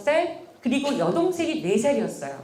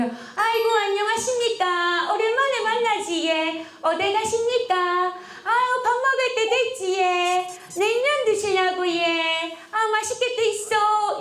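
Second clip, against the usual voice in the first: high, bright, gushing hello; effusive greeting; 8 semitones higher, slower than usual. Retold or imitated. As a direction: greet a stranger like an old friend. Mother greets a neighbor warmly. (엄마가 동네 아주머니에게 반갑게 인사)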